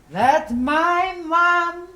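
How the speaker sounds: long drawn-out call across the road; loud holler. Retold or imitated. Boy calling out to her from down the road, loud drawn-out holler. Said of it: "hollering at me really loud as he could".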